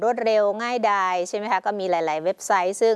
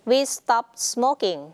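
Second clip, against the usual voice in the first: word by word, very slow; very slow example reading; 2 semitones higher, much slower than usual. Shown as a demonstration. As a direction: dictate it to the class. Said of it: "teacher reads example slowly". (อ่านช้ามากทีละคำ)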